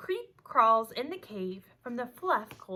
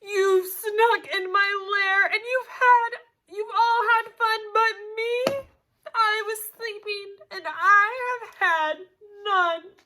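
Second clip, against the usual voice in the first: sobbing, wailing complaint, loud and high; bear crying; 7 semitones higher, 8 dB louder than usual. A narrator's reading. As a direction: wail it out like a hurt, left-out bear. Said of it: "And he whimpers and he moans".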